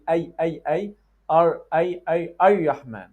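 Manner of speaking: 'Ay, Ay, Ay-Yahman' with Y from mid-tongue; middle-tongue Y for R. Shown as a demonstration. Teacher imitating students' mistake, R slipping into Y. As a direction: glide 'Ay' off the middle of the tongue. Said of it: "they go from the middle tongue".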